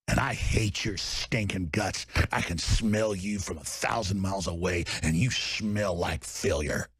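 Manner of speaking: host threatening his enemies, low and soft; low menace. Real, not acted. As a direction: growl it quietly at the enemy.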